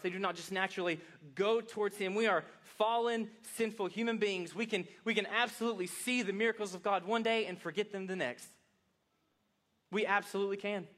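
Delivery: loud, high, driving sermon voice; impassioned preaching. Real, not acted. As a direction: preach it hard to the room. Preaching at full force.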